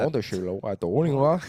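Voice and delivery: mock Chinese gibberish, rising at the end; fake foreign language. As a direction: babble sounds meant to pass as Chinese. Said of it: "so it's not real Chinese".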